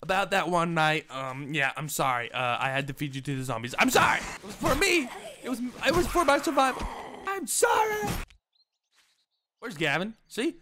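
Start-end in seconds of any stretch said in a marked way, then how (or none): 3.8-8.1 high, loud, escalating pleading; frantic mock apology. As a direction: beg forgiveness with hammed-up desperation. Pleading apology to zombie Melissa.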